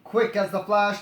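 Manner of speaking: usual speaking voice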